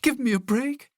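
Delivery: put-on a terrified girl pleading for her life; frightened plea. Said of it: "She cried".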